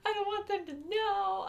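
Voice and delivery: high, slow whiny plea; pleading. Retold or imitated. Teen begging principal not to tell her parents, high and whiny. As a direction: plead like a desperate kid. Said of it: "But I was just like".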